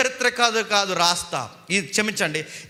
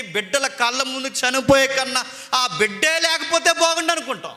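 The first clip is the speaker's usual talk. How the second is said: ordinary delivery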